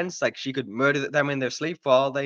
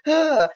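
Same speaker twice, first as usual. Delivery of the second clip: high, louder mock alien cry; mock yell; 14 semitones higher, 6 dB louder than usual. Reviewer voicing the alien bartender, high mock yell. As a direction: yelp high as the big alien. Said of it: "he's like".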